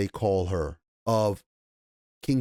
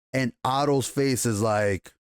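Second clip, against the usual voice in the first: ordinary delivery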